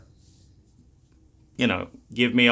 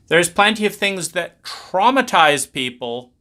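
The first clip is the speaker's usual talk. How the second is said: ordinary talk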